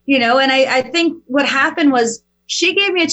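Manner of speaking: ordinary talk, nothing marked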